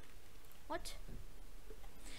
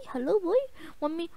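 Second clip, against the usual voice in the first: ordinary talk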